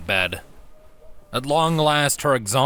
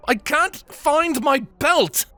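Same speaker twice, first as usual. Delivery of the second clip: muffled far off; 4 dB louder, slower than usual. Acted. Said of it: "muffled by walls and distance".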